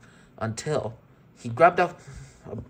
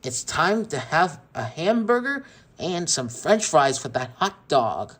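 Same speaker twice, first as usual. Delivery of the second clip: slow, louder, higher taunt; gloating bully; 4 semitones higher, 4 dB louder than usual. Acted. Sid lighting the barbecue for the toys, slow gloating. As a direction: draw it out, relishing the cruelty.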